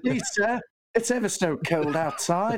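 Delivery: put-on high, pleading Cockney urchin accent; begging British urchin. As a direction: beg like a poor Victorian street child in a British accent. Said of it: "what's your best British accent".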